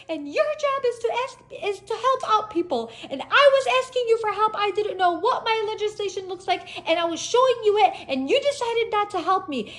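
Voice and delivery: high-pitched shouted scolding; indignant yelling. Retold or imitated. Driver telling off officer who refused to help, indignant yelling. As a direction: yell your grievance across a distance. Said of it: "And I was yelling too".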